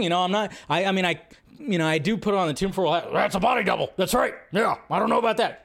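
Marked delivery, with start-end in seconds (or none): 3.1-5.6 a hint of Alex Jones, conspiracy rant; Alex Jones impression. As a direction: lightly mimic Alex Jones ranting, quicker at the end. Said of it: "That's my Alex Jones impression".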